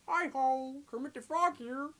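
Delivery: high, loud cartoon frog voice; Kermit the Frog. Shown as a demonstration. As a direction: call out in a goofy high frog impression. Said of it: "what my Kermit the Frog voice would sound like".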